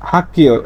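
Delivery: "hak-kio" for হাক্কিয়, no g sound; corrected k reading. Shown as a demonstration. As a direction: say হাক্কিয় with a voiceless double k. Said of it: "এর উচ্চারণটা হবে কি?".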